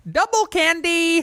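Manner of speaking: put-on high, drawn-out kid excitement; gleeful. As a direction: relive the glee of a jackpot. Acts out childhood delight.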